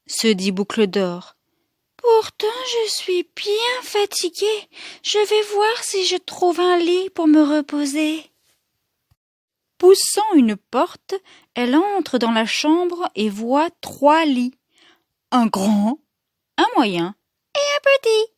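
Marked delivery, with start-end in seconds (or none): 2.0-8.3 high-pitched Goldilocks talking to herself; little girl voice. Acted. Tired Goldilocks looking for a bed, high girlish voice. 17.5-18.3 high, tiny little voice. A narrator's reading. Storyteller naming the smallest bed, tiny high voice.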